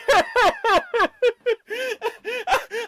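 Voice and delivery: shrill laughing wail; hysterical high laugh. Acted. Joey character breaking into laughter.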